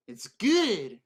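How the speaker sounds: loud, high burst of delight; excited exclaim. Acted. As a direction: blurt it out with relish. Savoring an orange, exclaims.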